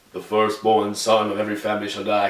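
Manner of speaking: lightly voiced calm, deep narrator God; a hint of a famous narrator's gravitas; Morgan Freeman impression. God decreeing death of firstborn, lightly voiced narrator. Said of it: "who talks like Morgan Freeman".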